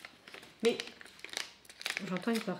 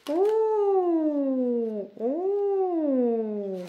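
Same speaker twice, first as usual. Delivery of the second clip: long, high, loud oohs gliding down; two drawn-out oohs at a reveal; excited surprise; 6 semitones higher, 6 dB louder than usual. Real, not acted. Reacts to the revealed book. (longs « oh » de surprise, aigus et forts)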